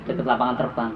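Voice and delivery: ordinary delivery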